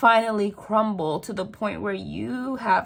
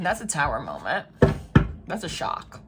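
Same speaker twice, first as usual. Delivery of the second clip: ordinary delivery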